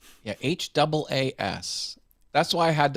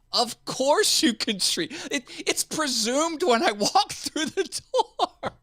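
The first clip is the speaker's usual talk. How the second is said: high, slow, laughing mock outrage; mock exasperation, laughing; 5 dB louder, slower than usual. To the nurse, laughing at the obvious question. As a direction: stretch it out, cracking up.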